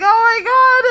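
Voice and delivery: high, loud panicked cry; panicked gasp. Retold or imitated. Reliving hitting the tarantula, high loud panicked cry. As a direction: shriek it like you just saw it die. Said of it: "And I was like".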